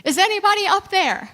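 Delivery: loud, high call from the cliff; yell for help. Retold or imitated. Man hanging from a cliff yells up for help. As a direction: shout up for help. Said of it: "and he yells".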